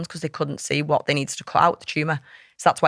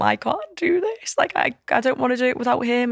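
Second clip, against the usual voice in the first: distressed plea; 8 semitones higher than usual. Retold or imitated. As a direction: voice lifts high and slows as she begs. Panicked plea to mum, retold.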